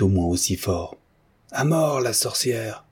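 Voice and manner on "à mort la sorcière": high, drawn-out mob cry; slow, raised call for blood. A narrator's reading. Someone in the mob condemns the witch, crying out. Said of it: "cria quelqu'un".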